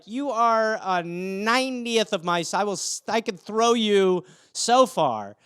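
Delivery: acted incredulous threat, drawn out; exasperated retort. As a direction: spell it out slowly to the cat. Owner's retort to the cat.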